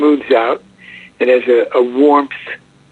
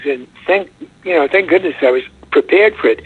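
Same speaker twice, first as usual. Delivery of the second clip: normal talking voice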